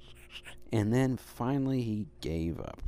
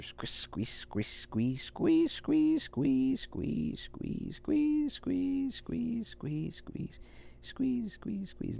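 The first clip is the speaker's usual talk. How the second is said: squeezing chant; 12 semitones higher, much slower than usual. A narrator's reading. Squeezing lemon sounds.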